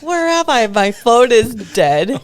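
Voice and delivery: high, loud, lost and panicking; mock panic, slowing down on the dead phone; retold panic. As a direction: blurt it out, suddenly frightened and lost. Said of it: "I'm like".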